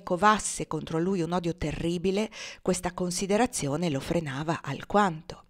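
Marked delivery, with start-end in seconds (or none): none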